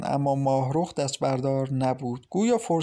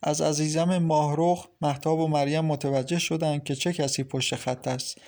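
plain reading voice

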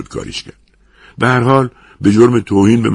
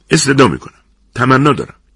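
high, loud polite pleading; pressing plea for order; urgent entreaty; 6 semitones higher, 4 dB louder than usual. A narrator's reading. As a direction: appeal insistently, voice raised. Restaurant boss pleads to stop the fight.

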